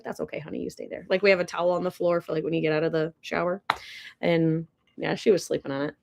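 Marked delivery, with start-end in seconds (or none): 0.0-1.1 soft, much quieter cooing; tender to cat. Retold or imitated. To the cat sleeping on the towel, soft and tender. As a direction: gently tell the cat she can stay. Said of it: "I was like".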